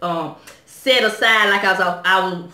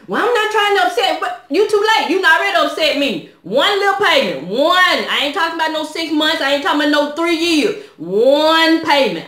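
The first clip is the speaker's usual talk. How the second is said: heated retort; 6 semitones higher than usual. Retold or imitated. Angrily telling off the supervisor.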